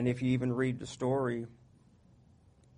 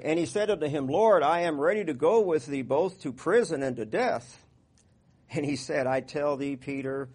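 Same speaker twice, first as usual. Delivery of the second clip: raised, measured Bible reading; scripture reading; 6 dB louder than usual. Acted. Pastor reading the Gospel to the congregation, raised and measured. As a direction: proclaim Peter's vow and Jesus' answer.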